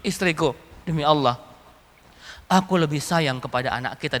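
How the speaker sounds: low, mostly soft solemn reply; Umar bin Abdul Aziz. Retold or imitated. Caliph explains to his wife his fear of answering to God, solemn. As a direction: answer gravely, keeping the voice down. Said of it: "Apa jawaban Umar bin Abdul Aziz".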